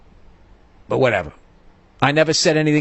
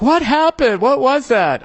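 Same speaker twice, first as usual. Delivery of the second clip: high, startled cry of alarm; alarmed staff; 11 semitones higher, 3 dB louder, slower than usual. Retold or imitated. Staff at the desk startled by the commotion. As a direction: front desk staff calling out in shock. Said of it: "people at the front desk were like".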